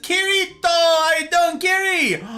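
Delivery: high, loud sing-out hello; shouted greeting. Real, not acted. Streamer hailing a viewer in chat, shouted hello. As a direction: holler a big welcome.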